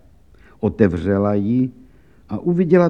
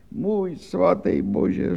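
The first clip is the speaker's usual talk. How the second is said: slow, high, softer groan to God; mock wail; 7 semitones higher, 7 dB quieter, much slower than usual. Laundress moaning to God before the official. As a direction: drag out the cry to heaven. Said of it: "řvala dál".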